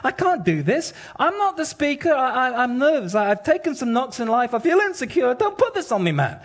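high, pleading, panicky Moses voice; nervous Moses. Retold or imitated. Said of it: "And Moses is like".

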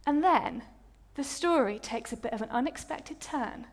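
normal talking voice

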